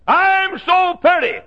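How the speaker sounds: high, slow, preening "I'm so pretty"; sing-song self-admiring mockery; mock vain boast. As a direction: mimic a vain sinner admiring himself. Mocking vain sinner.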